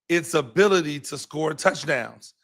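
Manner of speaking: normal talking voice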